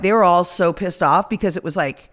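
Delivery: ordinary delivery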